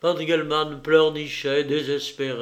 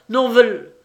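loud, high cry choked off mid-name; strangled cry; 8 semitones higher, 11 dB louder than usual. A narrator's reading. Banker's plea to Lupin choking off in his throat. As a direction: let the voice catch in the throat. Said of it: "La voix d'Engelmann s'étrangla dans sa gorge".

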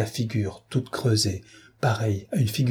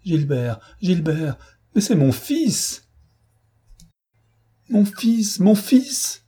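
climbing far higher and louder, slow, anguished; anguished cry; 9 semitones higher, 4 dB louder, much slower than usual. A narrator's reading. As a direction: wail it out for her son. Clarisse cries out her son's name. (Clarisse crie son désespoir de mère, très aigu et fort)

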